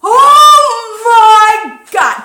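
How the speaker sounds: high, loud, slow cry of shock; frightened shout. Retold or imitated. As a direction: scream it out, drawn long and shaken. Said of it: "I went".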